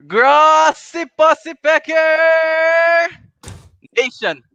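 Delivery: high, loud, stretched-out announcer call; hype announcer call. Acted. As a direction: boom it out slow like an arena announcer. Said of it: "that was the greatest introdu".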